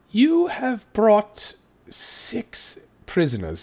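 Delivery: high, slow and pointed; a suspicious constable; slow accusing constable. Acted. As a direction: drag each word out as an accusation. Constable confronts the guardsman.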